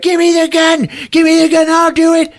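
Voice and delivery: put-on high, loud bratty teenage demand; bratty teen sister. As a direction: throw a screaming teenage tantrum. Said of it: "acting like a fucking sixteen-year-old sister".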